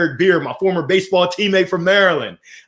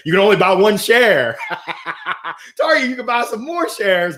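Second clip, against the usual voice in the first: laughing as you talk; 5 semitones higher than usual. Real, not acted. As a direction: tease fondly, breaking into a laugh. Joking about daughter's one share.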